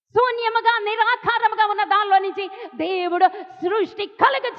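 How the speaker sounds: high-pitched, sustained preacher's cry; impassioned preaching. Real, not acted. Preacher proclaims Lazarus's story to grieving congregation, impassioned. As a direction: preach it out urgently, pitched well up.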